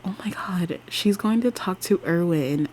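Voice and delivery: hushed, unvoiced whisper of worry; scared whisper. Real, not acted. Whispering to viewers, scared Raylan will talk to Erwin. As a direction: whisper it like you fear for her. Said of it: "I'm scared for her, girl".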